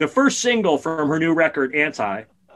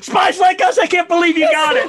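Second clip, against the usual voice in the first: much higher and louder, thrilled he got it; excited shout; 6 dB louder than usual. Real, not acted. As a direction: yell in delighted disbelief. Co-host guessed the answer.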